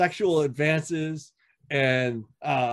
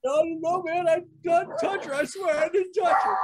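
very high-pitched, hammed-up pleading; panicked stammering; 13 semitones higher than usual. Prospect defending himself to the club, panicked stammering. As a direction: stammer out a squeaky mock-desperate denial. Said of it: "I'm like, just stammering".

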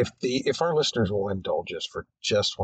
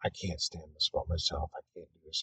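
quiet self-talk; 5 semitones lower, 10 dB quieter, faster than usual. Retold or imitated. Self-critical thoughts at the mirror.

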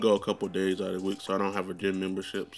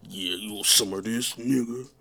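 a hint of a tough guy taunting; mock tough guy. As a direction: sneer it with a square-mouthed grimace. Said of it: "my mouth like a trapezoid".

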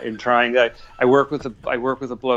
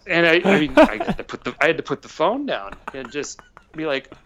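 usual speaking voice

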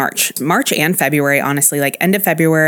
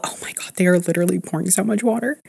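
fast shocked whisper; whispered disbelief; 3 semitones higher, 3 dB quieter, faster than usual. Real, not acted. Watching neighbor pour water.

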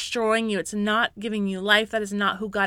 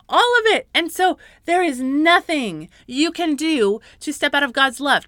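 passionate preaching; 6 semitones higher, 6 dB louder than usual. Real, not acted. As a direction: preach it with rising fervor. Preaching at full force.

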